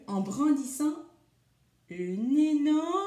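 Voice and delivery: building to a loud, high cliffhanger; dramatic storybook climax, left hanging; suspenseful build. A narrator's reading. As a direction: stretch the last words slowly, rising in pitch. Cliffhanger just before stopping the story.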